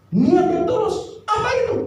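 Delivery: high, wondering voice; high questioning. Acted. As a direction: ask it in a high, puzzled tone. Preacher questions, high pitch. (nada tinggi bertanya-tanya)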